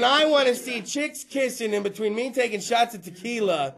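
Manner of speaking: ordinary talk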